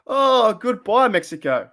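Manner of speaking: slow, high, drawn-out goodbye; see him off with mock sweetness; mocking farewell. Real, not acted. Kicking the troll out.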